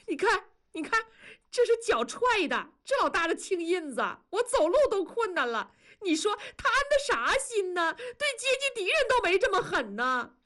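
high-pitched, sobbing complaint; tearful wailing. Acted. Beaten wife showing her bruises to the master, sobbing. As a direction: show the injuries while wailing high. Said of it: "立刻声泪俱下".

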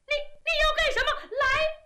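high, fast stammering alarm; startled guard trying to call for help; frightened guard crying out at sword point; panicked guard. Acted.

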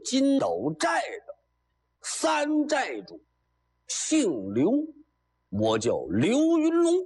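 slow, swooping bandit proclamation; boastful bandit. Acted. Bandit chief announces his name to the captive, grand drawn-out boast. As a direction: draw out each title with high rises.

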